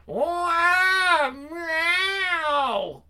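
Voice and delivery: high wailing kitten cry. Acted. Falling kitten crying out. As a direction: yowl as the kitten falls.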